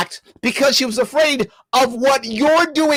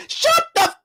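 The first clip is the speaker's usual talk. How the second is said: much higher near-scream, cut short; cut-off furious outburst. Real, not acted. Host nearly swears at co-host, higher near scream. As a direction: nearly lose it and bite it off.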